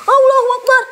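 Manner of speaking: sudden high, loud Allahu Akbar; startled cry. Real, not acted. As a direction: blurt it out in real shock. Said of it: "Kaget aing". (teriakan kaget spontan)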